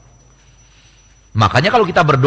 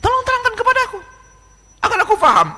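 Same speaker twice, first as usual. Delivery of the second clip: much higher, wheedling mock request; mock pleading; faster than usual. Preacher taunts the denier, in a high mock plea. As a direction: ask in a put-on high voice, teasing.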